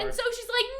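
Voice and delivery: ordinary delivery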